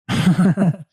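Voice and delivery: high, loud burst of laughter. Real, not acted. Host amused after the impression, real laugh.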